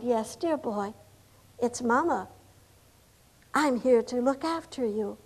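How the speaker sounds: very soft, low motherly comfort; tender reassurance. Acted. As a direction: soothe a dying boy gently. Comforting a dying soldier as his mother.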